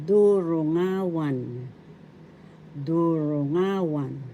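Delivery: durungawan slow, leaning on NGA; stress on third syllable. Shown as a demonstration. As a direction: say durungawan slowly as du-ru-NGA-wan. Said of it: "The accent is in the third syllable".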